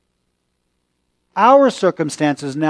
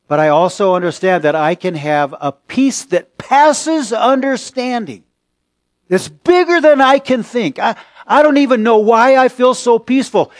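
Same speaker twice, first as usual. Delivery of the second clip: higher and louder, swelling with conviction; passionate preaching; 5 semitones higher, 4 dB louder than usual. Acted. Preacher testifies to his congregation about his strange peace, fervent and loud. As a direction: preach it with rising fervor.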